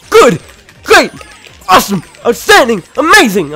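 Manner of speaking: loud, high hype calls; game announcer voice. Retold or imitated. Echoing game announcer praise.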